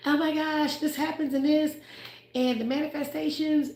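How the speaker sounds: high, bubbly parody of gushing fans; mock gushing. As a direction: toss it off like a starry-eyed fan. Mocking people who gush about blessings.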